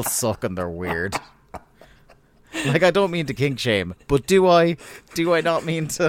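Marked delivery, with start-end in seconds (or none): 2.7-6.1 mock outburst. Real, not acted. Mock self-questioning kink shame joke.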